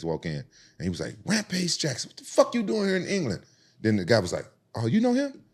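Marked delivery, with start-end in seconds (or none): 1.3-3.4 much higher, surprised greeting; excited recognition. Retold or imitated. Teammate recognizing him, excited. 4.7-5.4 surprised question. Retold or imitated. Bully surprised.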